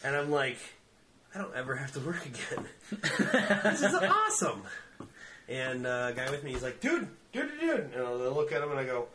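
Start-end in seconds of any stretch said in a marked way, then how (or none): 1.3-4.6 giddy laughing, voice climbing high. Retold or imitated. Stoned guy marveling at his wallet, laughing. 6.8-7.9 much higher, insistent repeats; urgent high calls. Retold or imitated. Friend trying to get his attention, urgent high calls.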